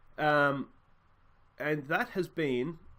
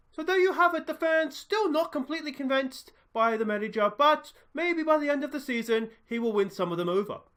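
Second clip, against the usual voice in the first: high, bright-pitched roving reporter, louder; 12 semitones higher, 6 dB louder than usual. Acted. Reporter outside the stadium asking fans, upbeat reporter voice. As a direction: play a chirpy outside-broadcast reporter. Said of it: "Hi, I'm Charlie Braithwaite".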